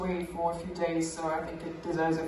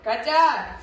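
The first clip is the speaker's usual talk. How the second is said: loud, raised-pitch mock shout of a name; dad's loud outburst; 5 semitones higher, 8 dB louder than usual. Dad calling out to his little daughter, mock shout. Dad's exasperated reply to child.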